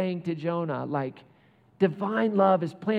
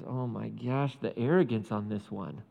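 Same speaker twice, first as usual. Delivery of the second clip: softer, lower dry aside; exasperated God; 7 semitones lower, 4 dB quieter than usual. Retold or imitated. God remarking on Jonah's arrogance, softer and lower. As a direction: mutter in disbelief at his nerve. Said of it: "He says".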